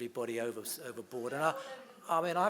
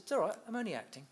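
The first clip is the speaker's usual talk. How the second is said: quick cheeky reply; 9 semitones higher, 4 dB louder, much faster than usual. Retold or imitated. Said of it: "And he said".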